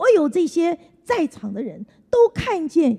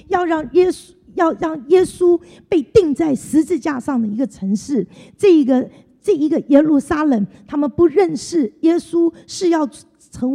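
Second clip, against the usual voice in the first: preaching; 3 semitones higher, 5 dB louder than usual. Acted. Sermon climax on Jerusalem.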